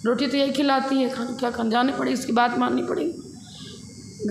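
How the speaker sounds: usual speaking voice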